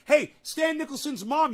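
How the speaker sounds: ordinary delivery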